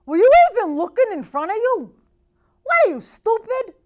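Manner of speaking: loud, high, furious shouting; irate driver yelling at the girl; angry yell. Retold or imitated. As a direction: bark it at the teen driver. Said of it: "and he goes".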